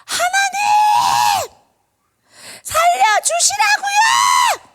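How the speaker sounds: very high, loud, drawn-out screamed plea; wailing prayer. Acted. Teacher acting a mother praying for her dying son, wailing. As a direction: scream it to God as a desperate crying mother. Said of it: "막 그렇게 울부짖었어요".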